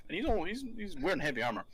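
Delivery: usual speaking voice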